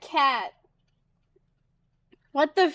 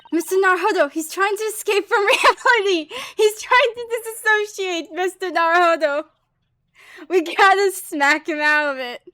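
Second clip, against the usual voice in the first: a lightly voiced excited girl, a bit higher; eager young girl; 4 semitones higher, 4 dB louder than usual. Assistant girl worrying Naruhodo is escaping reality, lightly voiced and eager.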